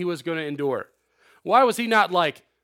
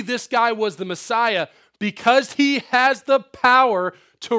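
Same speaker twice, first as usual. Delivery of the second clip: higher, louder preaching; preaching at full force; 6 semitones higher, 6 dB louder than usual. Acted. Preacher rousing his listeners about Jesus' power, in full preaching voice. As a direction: drive it home to the congregation.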